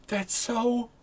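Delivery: high, gushing squeal after a gasp; Archfey. Acted. Archfey in the background cheering on a hug, high swooning excitement. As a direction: gush in a high voice, bursting into excited urging. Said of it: "you hear the Archfey going".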